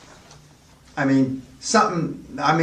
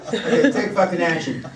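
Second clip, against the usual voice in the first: imitating Scottish accent; 3 semitones higher than usual. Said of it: "how do you say it in Scottish".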